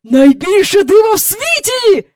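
high, loud, thrilled cry; excited exclaiming. A narrator's reading. Mister Banks bursting into the nursery, excited exclaiming. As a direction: proclaim it as a marvel. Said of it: "вигукнув він".

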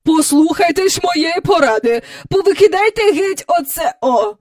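high, loud imperious advice; bossy visitor. A narrator's reading. Visitor ordering the hostess's flowers removed, bossy. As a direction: order it done.

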